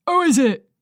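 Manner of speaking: loud call from another room; offstage shout. A narrator's reading. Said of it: "shouts a male voice".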